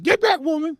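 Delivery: loud, high barked command; angry father. Retold or imitated. Father to mother as he grabs son, angry order. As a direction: imitate a dad yelling her back.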